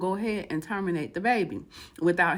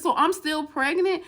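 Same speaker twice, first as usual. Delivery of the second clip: higher-pitched incredulous question; stunned disbelief; 6 semitones higher, 4 dB louder than usual. Retold or imitated. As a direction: ask in mock shock, voice raised. Stunned woman questions the doctor.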